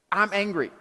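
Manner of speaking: normal talking voice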